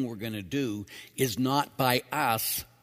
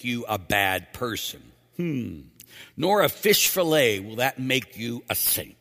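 loud, raised preacher's delivery; emphatic quote reading; 2 semitones higher, 5 dB louder than usual. Acted. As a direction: drive each point home to the congregation. Reads Pope's reflection forcefully.

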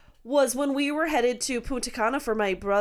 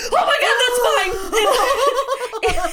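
much higher, louder, slow, laughing shout that's mine; laughing exclaim; 11 semitones higher, 7 dB louder, much slower than usual. Retold or imitated. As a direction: call out in laughing alarm. Spotting her purse held up.

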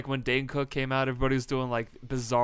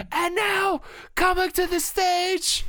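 very high, drawn-out announcer falsetto; mock stage announcer; 4 dB louder, much slower than usual. Comic retelling a bit introduces the Pope, mock announcer falsetto. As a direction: announce it in a squeaky put-on voice.

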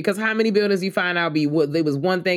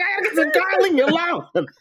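high, laughing put-on voice of a shook old man; Clifton Powell impression; 12 semitones higher than usual. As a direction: squeal it out like a scared man fleeing. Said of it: "He's like".

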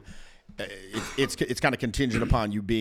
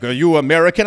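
cop question; 5 semitones higher, 10 dB louder than usual. Retold or imitated. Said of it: "I'm like".

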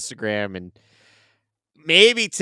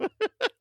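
high burst of laughter; 5 dB quieter than usual. Real, not acted. Laughing at the political joke.

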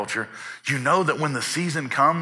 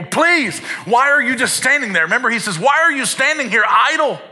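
much higher, louder desperate begging; 10 semitones higher, 6 dB louder than usual. Retold or imitated. Landowner begging idle men in the marketplace, much higher and louder. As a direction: plead as if the crop is dying now. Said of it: "he would begin to beg people".

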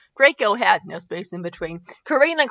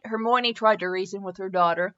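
ordinary delivery

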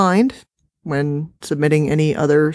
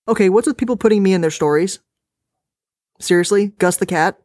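ordinary delivery, nothing marked

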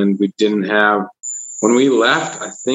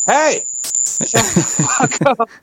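high yelled hey, shut up; shouting at crickets; 12 semitones higher than usual. Pretend. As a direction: yell out into the night. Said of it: "I just go like this".

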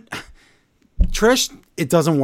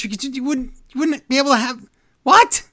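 incredulous rant; 10 semitones higher, faster than usual. Real, not acted. Ranting at bad wrestling writing.

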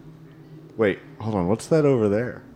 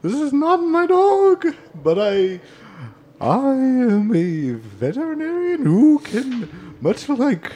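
high silly voice; 12 semitones higher than usual. Acted. NPC voice.

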